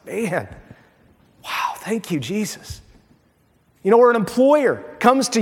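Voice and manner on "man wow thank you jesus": choked up. Real, not acted. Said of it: "I'm, like, almost choking up now".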